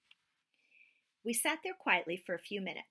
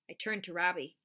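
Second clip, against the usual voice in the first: ordinary delivery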